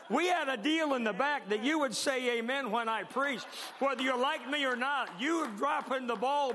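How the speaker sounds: loud, high-pitched playful indignation; hammed-up outrage at missed amens; mock scolding. Mock scolding congregation for missing amens.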